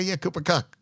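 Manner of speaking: ordinary delivery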